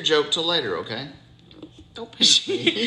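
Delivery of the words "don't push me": high laughing protest. Real, not acted. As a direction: laugh it out, playful. Laughing at child's push.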